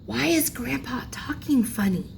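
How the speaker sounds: put-on a little girl's whisper to her mom; child whispering. A narrator's reading. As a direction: whisper the question so grandpa won't hear. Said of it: "and whispered".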